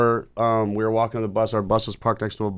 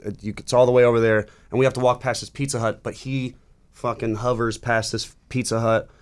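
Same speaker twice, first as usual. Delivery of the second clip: normal talking voice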